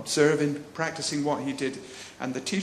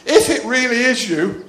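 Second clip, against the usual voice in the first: higher, louder plea; Peter pleading; 7 semitones higher, 8 dB louder than usual. Retold or imitated. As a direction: challenge him, half believing. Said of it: "and he says".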